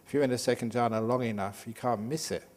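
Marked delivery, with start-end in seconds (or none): none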